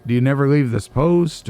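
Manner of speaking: ordinary talk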